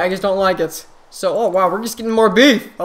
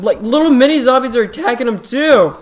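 normal talking voice

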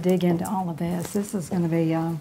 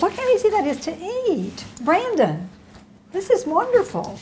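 high, swooping delight; delighted praise; 9 semitones higher than usual. Real, not acted. Host admiring the guest's dish, delighted and gushing. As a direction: gush over the food, pitch soaring.

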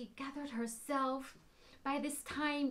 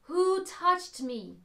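slow, louder, kind call over a crowd; 4 semitones higher, 7 dB louder, slower than usual. Acted. Jesus asking the crowd who touched him, kind and slow. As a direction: call out slowly without anger. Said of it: "There was no anger in the voice. There was kindness in the voice".

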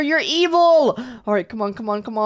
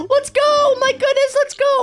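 excited cheer; 3 dB louder than usual. Real, not acted. Bench puzzle solved.

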